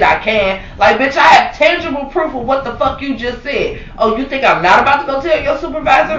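high-pitched, punchy indignant rant; acted-out confrontation. Employee confronting the manager who texted tangible proof, indignant.

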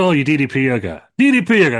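loud, high-pitched exclamation; mock excited shout. Barry Scott greeting the yoga wrestler, loud mock excitement.

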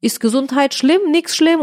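high broken German; imitating dad. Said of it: "Und der Papa so".

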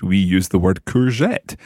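usual speaking voice

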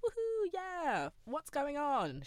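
high, slow whooping mum; imitating her cheering mum; cheery mum. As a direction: cheer without a clue. Mum voiced in the story.

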